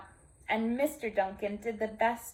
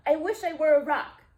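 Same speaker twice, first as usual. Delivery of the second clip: loud, high panicked blurt; frightened young donkey crying out; panicked wish; 6 semitones higher, 10 dB louder than usual. Acted. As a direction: blurt it out in terror. Sylvester panics facing the lion.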